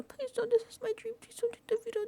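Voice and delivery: very high, pitiful mimicry of begging; mock pleading. Housemate begging to keep his veto, mock pleading. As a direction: squeak out desperate pleas mockingly. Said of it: "she didn't do the".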